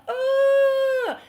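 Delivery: long, high, loud "ooh"; a drawn-out high wail of nerves; nervous "ooh". Retold or imitated. Acts her reaction to sky lift.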